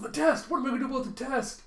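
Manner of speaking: put-on high, loud, rushed child panic; hammed-up frantic yelp; freaking out. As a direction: blurt it out fast in a panic. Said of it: "Anya freaking out in the morning".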